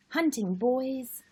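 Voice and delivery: usual speaking voice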